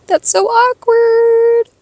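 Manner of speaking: high, drawn-out awkwardness; singsong cringe. Retold or imitated. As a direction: stretch it high and slow, held at the end. Cringing at awkward exit, retold.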